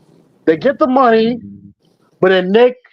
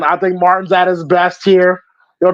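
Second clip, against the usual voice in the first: normal talking voice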